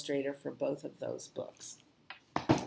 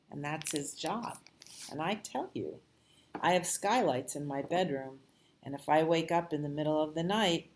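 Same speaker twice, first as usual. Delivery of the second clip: ordinary delivery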